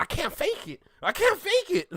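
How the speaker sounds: high, rising squeal of despair; Kai Cenat impression. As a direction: mimic a streamer cracking up high. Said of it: "it's like the Kai Cenat moment".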